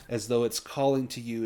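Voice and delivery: ordinary talk, nothing marked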